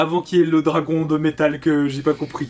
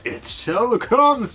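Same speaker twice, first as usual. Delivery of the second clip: put-on old man voice; 5 semitones higher, much slower than usual. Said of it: "un vieux qui disait".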